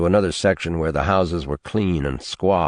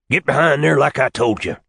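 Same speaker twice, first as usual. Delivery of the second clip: a sharp command, louder and higher; impatient old man's order; 8 semitones higher, 5 dB louder than usual. Acted. Old man ordering Tarwater behind the hedge, loud and impatient. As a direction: push him along with your voice.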